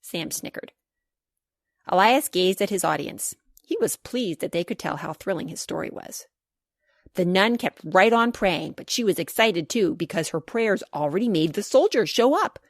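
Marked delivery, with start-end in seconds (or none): none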